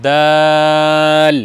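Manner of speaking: দাল held very long and slow; drawn-out letter. Shown as a demonstration. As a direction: stretch দাল out for pupils to copy. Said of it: "আমার সঙ্গে বলতে থাকুন". (ধীরে টেনে দাল উচ্চারণ)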